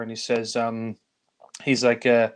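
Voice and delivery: ordinary talk, nothing marked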